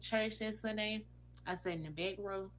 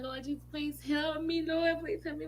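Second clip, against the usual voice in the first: desperate prayer; 8 semitones higher than usual. Retold or imitated. Praying in the bathroom, denial.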